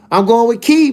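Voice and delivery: normal talking voice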